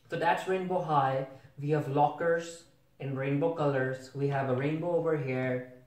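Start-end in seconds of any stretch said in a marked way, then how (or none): none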